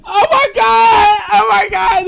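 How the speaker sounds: high, loud wailing laugh; mock sob through laughter; laughing cry. Real, not acted. Host howling at herself ready to cry.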